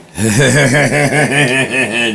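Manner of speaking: high loud burst of laughter. Real, not acted. Streamer bursts out laughing at the accent joke.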